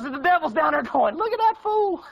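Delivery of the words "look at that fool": high, jeering devil with a cackle; mocking devil.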